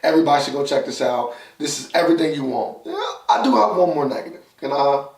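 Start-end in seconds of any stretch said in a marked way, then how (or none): none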